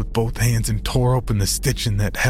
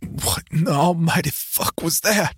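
quavering; 7 semitones higher than usual. A narrator's reading.

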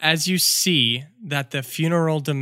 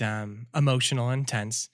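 ordinary delivery

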